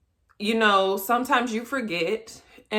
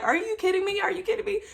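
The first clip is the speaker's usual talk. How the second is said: high, repeated incredulous question; insistent disbelief; 11 semitones higher, 3 dB quieter, much faster than usual. Retold or imitated. Patient kept asking her doctor, high insistent disbelief. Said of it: "And I kept asking".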